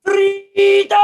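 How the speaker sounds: very high, loud, long-held yell; William Wallace war cry. Pretend. Scot warming up on arrival, long high bellow. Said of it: "You chanted his mantra as you arrived".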